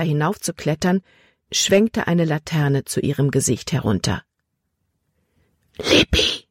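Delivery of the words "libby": urgent whispered name. A narrator's reading. Caleb spots the girl by lantern light, whispering. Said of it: "Calebs Stimme war ein Flüstern".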